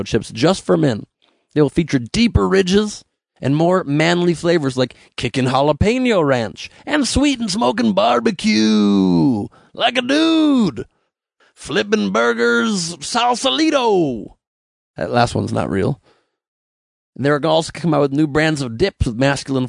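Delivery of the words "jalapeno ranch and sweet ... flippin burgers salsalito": lightly put-on slow macho voice, drawn out; mock macho ad voice. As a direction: sell the flavors like a tough dude, slow and stretched. Mock manly chip flavor names.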